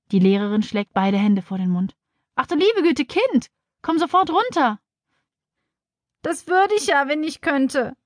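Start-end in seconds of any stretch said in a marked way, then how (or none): 2.3-4.8 high-pitched alarmed scolding without shouting; horrified teacher. A narrator's reading. Teacher horrified at child on fence. 6.2-8.0 put-on high-pitched plaintive child; whining. A narrator's reading. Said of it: "jammert Tina".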